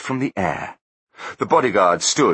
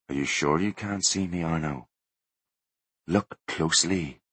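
soft ghostly taunt; 2 semitones lower, 7 dB quieter than usual. A narrator's reading. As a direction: draw him in quietly. Ghostly Butler voice.

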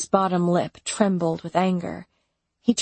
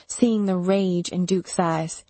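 ordinary delivery, nothing marked

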